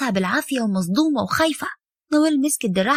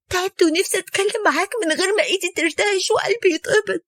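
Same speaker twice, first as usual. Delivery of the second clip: higher, crying daughter's voice; crying plea; 5 semitones higher than usual. A narrator's reading. Daughter crying as she begs her father to hear her. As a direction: beg her father through tears.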